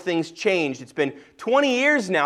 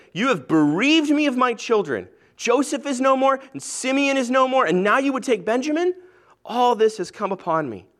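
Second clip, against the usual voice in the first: a hint of an anguished old father, pitched higher; grieving father Jacob; 7 semitones higher than usual. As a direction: lightly voice Jacob's lament over his lost sons. Jacob laments losing his sons.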